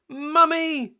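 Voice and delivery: high, loud panicked call; shouting. Acted. Peppa calling Mummy to save her snow castle, high shout. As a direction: yell for help. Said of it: "shouted Peppa".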